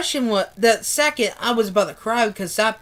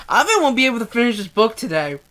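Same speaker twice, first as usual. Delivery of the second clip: usual speaking voice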